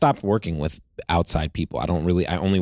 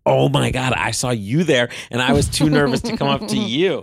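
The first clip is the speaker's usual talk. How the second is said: imitating her gushing back, higher and louder; excited echo; 7 semitones higher, 4 dB louder than usual. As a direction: throw his words back at him, thrilled and bubbly. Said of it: "And she was like".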